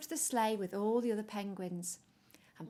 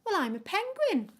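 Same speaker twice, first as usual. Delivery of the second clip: much higher, louder proud penguin reply; chirpy penguin; 11 semitones higher, 9 dB louder than usual. Acted. Peppy telling the polar bears what he is, high and bright. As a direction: answer brightly.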